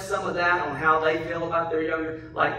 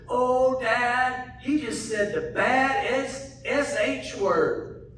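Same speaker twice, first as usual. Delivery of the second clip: put-on higher, childlike tattling tone; little girl voice; 7 semitones higher, much slower than usual. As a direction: play the shocked little daughter telling on the radio. Seven-year-old daughter voiced.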